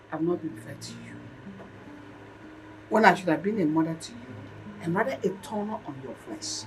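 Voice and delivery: soft, subdued admission; low-key, halting apology from a mother; remorseful confession. Acted. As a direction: confess gently with regret. Mother's remorseful confession to her children.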